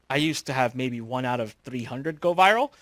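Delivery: ordinary delivery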